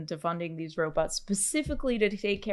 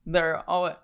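usual speaking voice

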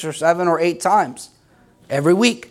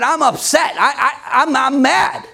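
higher-pitched, hammed-up complaint; mock upset; 5 semitones higher than usual. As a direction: act out your own tantrum to God, lightly. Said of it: "talk like that".

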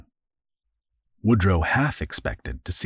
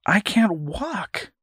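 put-on higher, slow boyish protest; 12 semitones higher, slower than usual. Boy tells captor he can't walk, slow protest. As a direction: whine it like a hurt kid.

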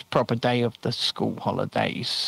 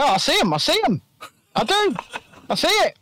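excited; 11 semitones higher than usual. Real, not acted. Excited at seeing raised eyebrows.

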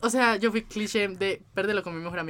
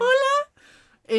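very high sing-song fake hello; mock greeting; 3 dB louder than usual. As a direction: chirp it like a shy teen online. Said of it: "ahí como". (saludo agudo y cantadito de burla)

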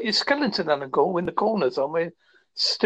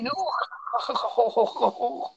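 put-on Scottish accent; 5 semitones higher, much slower than usual. Mocking thick northern accent.

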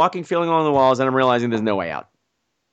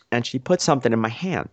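ordinary talk, nothing marked

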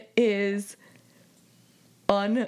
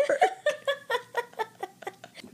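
high trailing giggle; laughing about scaring the neighbors; 4 dB quieter than usual. Real, not acted. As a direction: giggle at her own excitement. Imagining screeching at the arc.

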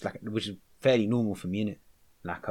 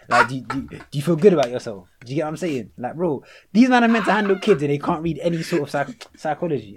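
angry rant; 4 semitones higher, 8 dB louder than usual. Real, not acted. Ranting at teachers, loud.

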